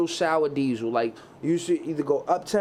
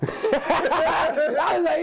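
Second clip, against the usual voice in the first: laughing through the words; 10 semitones higher, 3 dB louder, much slower than usual. Real, not acted. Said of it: "laughs while saying".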